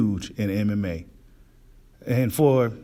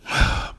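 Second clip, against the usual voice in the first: heavy sigh. Real, not acted. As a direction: let out a big exhale. Exasperated at fans' criticism.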